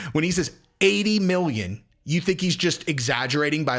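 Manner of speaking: usual speaking voice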